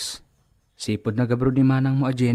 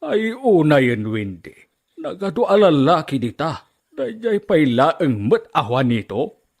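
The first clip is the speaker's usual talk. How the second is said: angry father; 2 semitones higher, 4 dB louder than usual. Acted. Father scolds daughter about Juanito.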